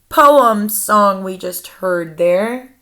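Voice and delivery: ordinary delivery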